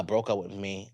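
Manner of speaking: ordinary talk, nothing marked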